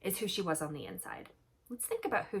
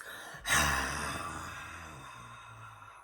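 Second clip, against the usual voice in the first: long sigh; 4 dB quieter than usual. Acted. Dragon exhausted after breathing fire.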